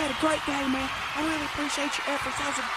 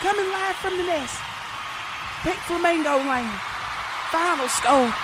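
live broadcast announcer; 4 semitones higher than usual. Acted.

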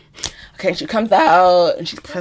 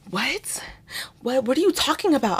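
soft, higher-pitched puzzled mumble; groggy confusion; 4 semitones higher, 11 dB quieter than usual. Retold or imitated. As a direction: lightly imitate a confused, just-woken housemate. Just-woken housemate, confused.

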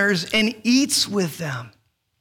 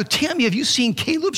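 higher, mildly panicked question; retold worry; 8 semitones higher than usual. Dad asking wife about missing son, mildly panicked. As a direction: re-enact a worried parent asking.

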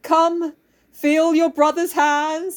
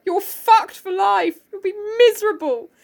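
mock Oedipus doom; 8 semitones higher than usual. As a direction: proclaim their doom with comic relish. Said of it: "he's basically like".

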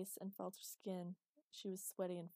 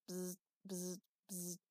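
short repeated buzzing noises; imitated phone buzz; slower than usual. A narrator's reading. Phone ringing after he tends to her, imitated buzz.

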